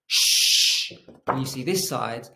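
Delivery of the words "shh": long, breath-only "Shh"; sh sound demo. Shown as a demonstration. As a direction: model "Shh" as one held hiss. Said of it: "I want you to say".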